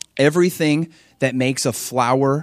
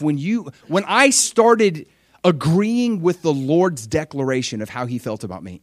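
ordinary delivery

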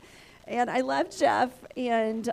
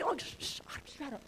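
hushing herself; 2 semitones higher, 11 dB quieter than usual. Retold or imitated. Hushing herself after screaming.

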